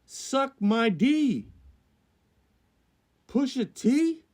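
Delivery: high, loud incredulous rant; scandalized, squeaking up at the ends; outraged disbelief. Real, not acted. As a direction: blurt each bit in high-pitched indignation. Outraged reaction to the rankings.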